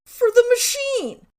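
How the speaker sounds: high, loud mock shout; exasperated customer. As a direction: shout it as if to a total idiot. Impatient customer waving at slots.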